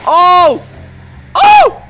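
high, loud yelps, rising sharply; startled screams. Real, not acted. Car flies off a ramp.

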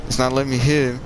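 usual speaking voice

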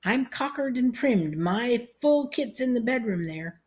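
put-on high, slow old-man voice; eager old Uncle Grady. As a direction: boast slowly like an aged soldier.

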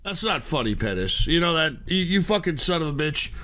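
low, hushed, bitter scolding; quiet reproach. Real, not acted. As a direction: reproach him softly and deep. Hurt by donor's death prank.

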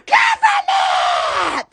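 high, slow mock battle cry; playful shout. Joking in the store aisle, mock battle cry. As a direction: yell it like an action hero.

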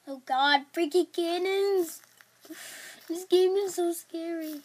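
loud startled cry of fright; scared outburst. Real, not acted. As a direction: blurt it out in a panic. Jump scare in horror game.

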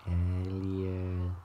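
drawn-out alien call in a changed voice; a light alien voice; E.T. impression. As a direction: call the name slowly like the alien. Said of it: "impersonation of Elliot".

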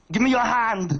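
put-on Austrian accent, clipped action-hero line; Arnold Schwarzenegger impression. Comic doing Schwarzenegger saving someone, Austrian accent. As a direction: hand it over like Schwarzenegger on a cliff, Austrian accent. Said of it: "Quick impression".

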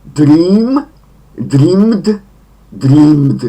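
loud drill of dream, dreamed, dreamed with long ee. Shown as a demonstration. Teacher repeats the regular forms, long-vowelled dream, dreamed. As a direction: lift DREAM high, let the last dreamed fall, ee held long. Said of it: "wydłużone i".

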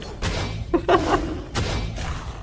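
airy high giggle at a teammate's miss; breathy giggle. Real, not acted. Laughing at Julio's miss, breathy giggle. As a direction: let a breathless giggle slip out.

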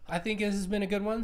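talking through a yawn. Real, not acted. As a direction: yawn while speaking. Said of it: "Sorry, I'm yawning a bunch".

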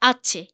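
"আছে" as Spanish hache, with no H sound; silent H. Shown as a demonstration. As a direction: start "আছে" straight on the vowel, H silent. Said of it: "এইচটা আছে এটা silent".

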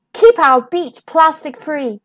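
ordinary delivery, nothing marked

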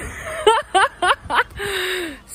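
high, bursting giddy laugh; real happy laughing fit. Real, not acted. Laughing after the happy visit.

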